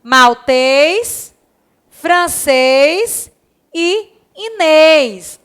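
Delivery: maltês, francês and Inês each with a closed, stressed final ês; stressed final syllables. Shown as a demonstration. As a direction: lean on the final ês of each word. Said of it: "Olha o acento".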